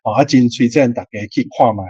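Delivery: ordinary talk, nothing marked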